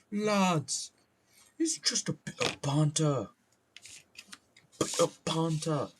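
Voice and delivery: high, put-on British lad voice; punchy mock-British with popped consonants; violent British accent. Streamer calling out to imagined lads, violently British. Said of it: "My British accent is very violent".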